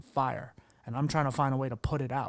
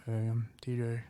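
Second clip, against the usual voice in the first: a bit softer and deflated, no energy; sheepish; 3 semitones lower, 5 dB quieter than usual. Retold or imitated. Son meekly greeting mom's friend at the store, sheepish. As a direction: mumble it like you want to disappear. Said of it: "just sheepish".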